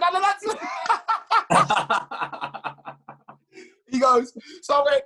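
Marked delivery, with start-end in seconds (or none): 0.0-0.6 high, disbelieving repeat of "Alan Hansen"; "Alan Hansen" thrown back sky-high and louder; incredulous echo. Retold or imitated. 0.6-3.3 high laughter. Real, not acted. Laughs after incredulous echo.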